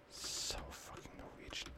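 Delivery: a self-mocking whisper under his breath, "So fucking Norwegian."; a breathy aside to himself. Real, not acted. Gamer grumbling to himself about his accent, whispered.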